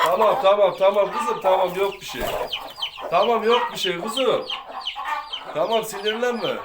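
high, loud soothing to an angry hen; soothing call. Real, not acted. Calming the angry mother hen.

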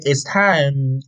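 ordinary talk, nothing marked

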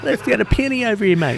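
high, hammed-up cockney; exaggerated fake cockney; mock British accent. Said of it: "a British accent".

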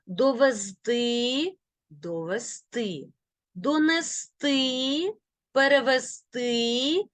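each verb, відвести to перевести, with long stressed ти; final-syllable stress. Shown as a demonstration. Teacher drilling verbs from the screen, end stress. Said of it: "будуть наголошуватися на останній склад".

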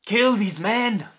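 slow, high, loud shout. Acted. Navigator demanding the pirates die, slow loud shout. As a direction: shout the order fiercely. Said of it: "shouted Aberdeen Angus".

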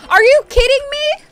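high, very loud disbelief; exasperated shouted question; outraged yell. Real, not acted. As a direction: scream it at the game in frustration. Raging after losing bets in game.

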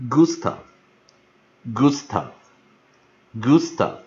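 "Gustav" said very slowly, GUS stressed, final v like f; slow the word "Gustav" way down, stress on the first syllable; played site pronunciation. Shown as a demonstration. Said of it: "找到它这个发音".

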